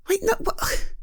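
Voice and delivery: startled whisper, breathy and high. Real, not acted. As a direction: hush it out in disbelief. Reacts to shocking remark.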